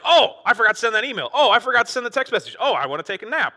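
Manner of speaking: suddenly remembering chores, high and loud; frantic distracted thoughts. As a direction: blurt out stray thoughts mid-prayer.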